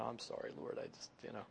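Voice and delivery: whispered, trailing apology to God; sheepish whisper. Retold or imitated. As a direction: breathe it out, embarrassed and caught. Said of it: "I read this scripture and I went".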